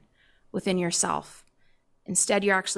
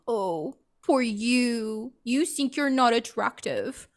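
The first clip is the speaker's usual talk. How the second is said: self-mocking, drawn-out fake sympathy; mock pity; slower than usual. Making fun of her own insecurity, mock pity. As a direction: pity yourself sarcastically, laying it on thick. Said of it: "started to make fun of myself".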